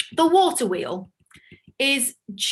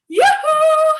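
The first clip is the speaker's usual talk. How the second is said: high, loud call across the street; calling yoo-hoo; 8 dB louder than usual. Shown as a demonstration. Teacher calling to a friend on the far side of the street, a high loud call. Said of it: "Three, two, one".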